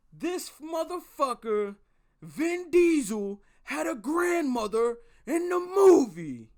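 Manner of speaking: loud, high, drawn-out outrage; imitated livid yelling. Livid friend yelling about a movie over the phone, outraged shouting. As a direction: yell it slowly in disbelief, hitting each phrase. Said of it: "you're yelling like the fucking gumball".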